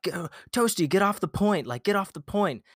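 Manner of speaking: teammates yelling. Retold or imitated. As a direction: squeak out the bossy order like a whiny gamer. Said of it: "they were like".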